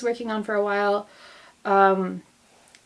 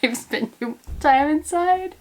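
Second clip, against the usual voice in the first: higher, giggling unhinged voice; cabin-fever giggles, pitched up; manic laughing; 6 semitones higher than usual. Real, not acted. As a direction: laugh like you're losing it.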